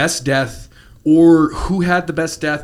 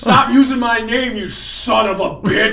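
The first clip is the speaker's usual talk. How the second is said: mock angry yell; 5 semitones higher than usual. Playing the villain for a friend, as a high, hammed-up yell. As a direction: bark it like a campy villain.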